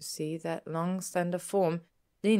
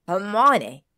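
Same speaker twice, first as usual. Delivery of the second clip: loud, raised yell of a name; 4 semitones higher, 13 dB louder than usual. A narrator's reading. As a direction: yell to cut someone off. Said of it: "Harry yelled".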